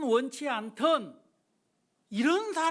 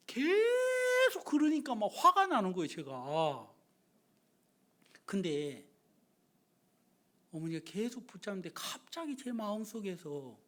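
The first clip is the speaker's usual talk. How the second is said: usual speaking voice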